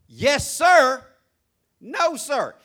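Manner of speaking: loud, high, emphatic Yes, sir; demanded answer. Retold or imitated. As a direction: bark the polite answer out as the rule. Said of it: "I say".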